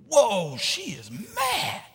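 stretched-out, swooping mock astonishment; astonished reaction. Teen thinking as a woman yells in tongues, comically astonished. Retelling his shocked reaction to yelling.

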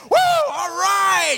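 loud, slow celebratory whoop. Acted. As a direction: cheer it out like buddies high-fiving. Friends celebrate getting him up.

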